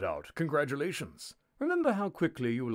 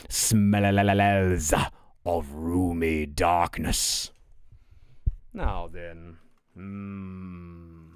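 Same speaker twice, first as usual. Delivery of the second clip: 1 ominous narration; 4 semitones lower, 7 dB louder, much slower than usual. Acted. Narrator's dramatic description.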